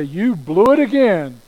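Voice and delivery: scolding God. Acted. Harsh God berates you for failing, loud and scolding. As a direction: blurt it out loudly as an exasperated rebuke. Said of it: "Do you hear God say".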